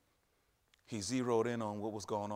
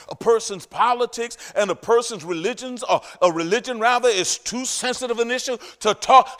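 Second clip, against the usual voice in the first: high, much louder fiery preaching; preaching at full force; 11 semitones higher, 17 dB louder than usual. Acted. Preacher thundering at the congregation at full force. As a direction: preach it with all your fire.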